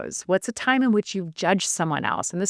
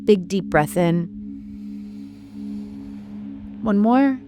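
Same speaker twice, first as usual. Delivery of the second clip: guided meditation. Shown as a demonstration. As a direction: lead the breathing gently, letting each cue hang. Leads a guided breathing practice.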